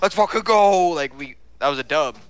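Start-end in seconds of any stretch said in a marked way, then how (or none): 0.0-0.9 higher and louder, triumphant; hyped cheer. Retold or imitated. Celebrating leaving with the girl, loud hype cheer. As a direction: cheer it out like a win. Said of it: "I was like".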